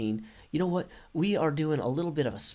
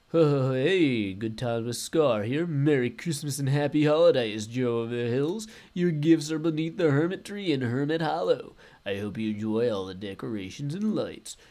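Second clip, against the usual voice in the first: Scar voice; 4 dB louder than usual. Retold or imitated. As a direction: play your buddy leaving a holiday note. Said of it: "oh, I gotta do my Scar voice".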